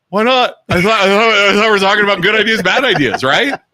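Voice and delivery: retold indignant comeback; indignant protest. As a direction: act out arguing back in a meeting. Retelling his arguing back in meetings.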